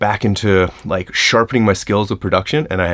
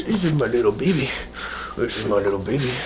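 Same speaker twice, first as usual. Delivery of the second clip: soft cooing to a pet; 2 semitones higher, 7 dB quieter than usual. Real, not acted. Cooing over his dog.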